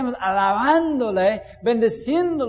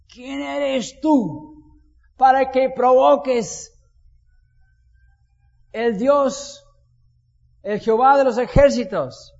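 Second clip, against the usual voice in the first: David defying Goliath; 3 dB louder than usual. Retold or imitated. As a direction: hurl the taunt back at the giant. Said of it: "¿qué decía David?".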